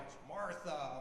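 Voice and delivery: quiet tentative guess; guessing to himself. Retold or imitated. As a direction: try the name out silently. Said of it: "I'm like".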